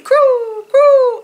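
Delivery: high, long falling coos; cooing doves. A narrator's reading. Narrator voices doves near Owl, drawn-out high coos. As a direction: coo like a dove, letting each fall. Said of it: "and the doves cooed".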